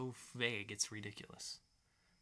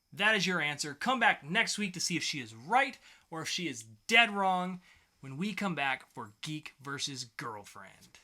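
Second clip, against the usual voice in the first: punchy podcast host outro, a bit louder, slowing at the end; show host sign-off; 4 semitones higher, 7 dB louder than usual. Acted. Host closing the round for listeners, upbeat announcer read. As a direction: sell the cliffhanger like a game show host.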